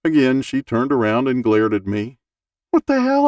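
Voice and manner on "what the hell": high, louder indignant "what the hell"; exasperated outburst. Retold or imitated. Passenger glared at, bursting out in exasperation. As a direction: blurt it out, fed up with her. Annoyed thought at glaring woman.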